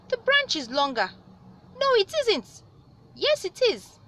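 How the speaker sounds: high, loud bickering; mock argument. A narrator's reading. Spider and wife arguing.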